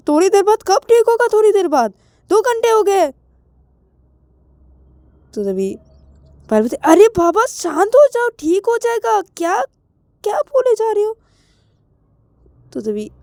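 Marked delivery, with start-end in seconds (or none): 0.1-3.1 high, loud exasperated complaint; worked-up protest, pitched way up; exasperated rant. Acted. Acted rant over the doctor. 6.8-11.2 acted exasperation, pitched way up; exasperated. Acted quarrel, telling friend to calm down.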